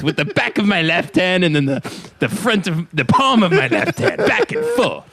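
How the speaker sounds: lightly played indignant pimp character; Jack Lemmon as pimp. Man confessing to Kevin how he punished her, hammed-up pimp. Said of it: "this character is Jack Lemmon if he was a pimp".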